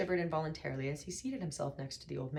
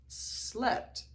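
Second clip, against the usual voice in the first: incredulous; 8 semitones higher than usual. A narrator's reading. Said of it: "said Arthur incredulously".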